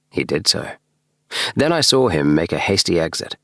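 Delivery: usual speaking voice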